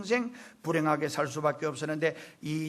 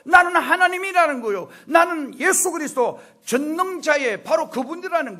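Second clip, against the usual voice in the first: high, loud, driving declarations; impassioned preaching; 9 semitones higher, 8 dB louder than usual. Real, not acted. Pastor voicing Jesus's claim to be God, impassioned. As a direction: proclaim each line at full pulpit force.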